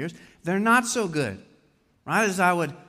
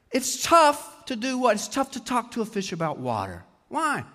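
normal talking voice

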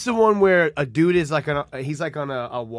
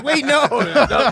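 much higher, louder laughing protest; mock panic; 10 semitones higher, 5 dB louder, slower than usual. Hosts pretending to panic at Lewis, high squeal. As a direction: squeal it like you're scared. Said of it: "We're like".

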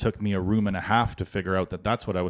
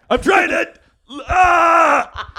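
screaming mock rage, much louder and higher; furious yell; 9 dB louder than usual. Dad yelling at kids interrupting his tiling, furious. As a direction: blow up, hammed-up fury at the kids. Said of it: "I'd be like".

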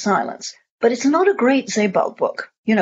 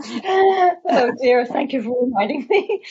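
higher, laughing through the words; amused laughing; 4 semitones higher, slower than usual. Real, not acted. Speaker teased by host about running long, amused laughing. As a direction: laugh while thanking them for the reminder.

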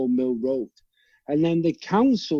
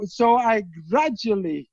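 ordinary delivery

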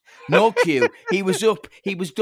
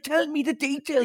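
much higher, mock eager demand; eager plea; 11 semitones higher than usual. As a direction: plead for details, playfully. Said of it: "You're like".

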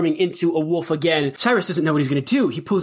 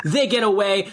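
ordinary talk

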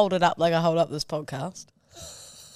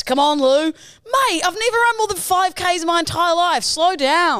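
higher, louder strained pleas; mock runner pleading; 9 semitones higher, 4 dB louder than usual. Begging the coach during a hard run, high and loud. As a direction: beg the coach for mercy.

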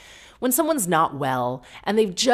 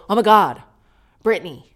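louder, startled Oh my God; loud gasp; 3 semitones higher, 9 dB louder than usual. Real, not acted. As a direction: exclaim in shock. Gasping at glimpse of [name].

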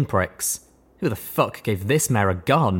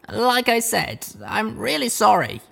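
apologetic; 13 semitones higher, slower than usual. A narrator's reading. Apologizing again.

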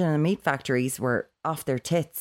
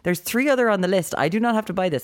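imagined inner panic; 6 semitones higher than usual. Retold or imitated. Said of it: "you're like".

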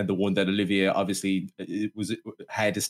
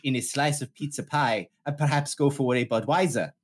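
hammed-up British thespian, raised pitch; Olivier; 4 semitones higher than usual. Olivier proposing pizza and beer to his flatmate, grandly British.

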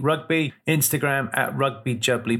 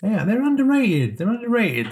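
much higher, louder, pressing the point; emphatic insistence; 10 semitones higher, 3 dB louder than usual. Real, not acted. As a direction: insist hard that they're underrated. Emphatic defence of Wales.

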